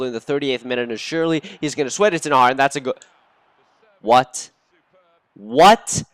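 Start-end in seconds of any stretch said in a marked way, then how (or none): 4.0-6.0 disbelief. Real, not acted. Shocked at missed chance.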